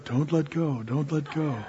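soft, distant echo of a father's warning; echoing dad's voice. Retold or imitated. Remembered dad's voice echoing in the boy's mind, soft and distant. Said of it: "my dad's voice was echoing".

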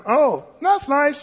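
high, slow frog voice; a drawn-out, high-pitched cartoon reply; wide-mouth frog. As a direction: chirp back as the joke frog. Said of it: "the wide mouth frog would walk around".

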